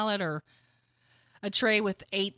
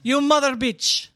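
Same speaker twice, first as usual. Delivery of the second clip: hammed-up Russian scolding; terrible Russian accent; 6 dB louder than usual. Shown as a demonstration. Host continuing the accent bit, mock Russian scolding. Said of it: "My Russian accent is, is absolutely terrible".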